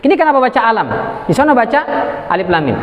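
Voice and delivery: ordinary delivery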